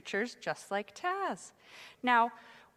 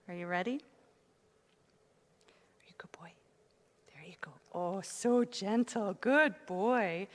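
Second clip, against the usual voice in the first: talking to a dog; 2 semitones lower than usual. Real, not acted. Giving the dog a treat.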